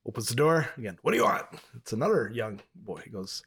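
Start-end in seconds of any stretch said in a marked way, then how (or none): 1.0-1.5 higher, gruff demand; gruff dad. Retold or imitated. Dad demanding of second suitor, gruff. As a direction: snap it at the second boy.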